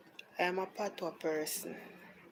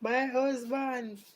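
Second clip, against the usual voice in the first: high, slow wailing call in an African accent, a hint of panic; retelling a skit lady in an African accent, mock distressed; imitating African accent; 7 semitones higher, 4 dB louder than usual. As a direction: cry out for her husband in a mock African accent. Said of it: "she was running and calling".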